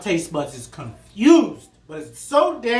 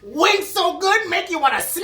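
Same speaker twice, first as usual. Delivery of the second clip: hyped up; 10 semitones higher, 4 dB louder than usual. Real, not acted. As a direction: sell it big. Hyping the wings.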